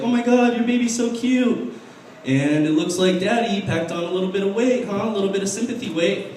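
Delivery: high-pitched mock cooing over a baby; gushing old woman. Old women approaching a man with a baby, gushing imitation. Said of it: "all these old women would come up".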